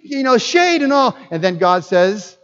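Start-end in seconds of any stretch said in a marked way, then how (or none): 0.0-1.2 high-pitched indignant retort; petulant, higher self-justifying protest; Jonah. Acted. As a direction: whine back at God in mock anger. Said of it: "And now Jonah is angry".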